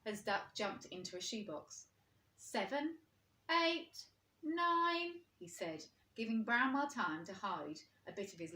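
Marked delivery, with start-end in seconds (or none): 2.4-5.2 higher, louder counting; counting aloud. A narrator's reading. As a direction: count out for hide and seek. Old Bear counting in game.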